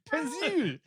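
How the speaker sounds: "Pencil" pitched high in mock Austrian-French; Austrian-French accent. Shown as a demonstration. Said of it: "How do you say pencil is Arnold Schwarzenegger Austrian French".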